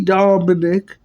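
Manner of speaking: ordinary talk, nothing marked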